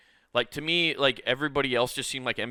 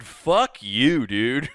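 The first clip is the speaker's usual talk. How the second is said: defiant retort; 2 semitones higher, 7 dB louder, much slower than usual. Retold or imitated. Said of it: "And I said".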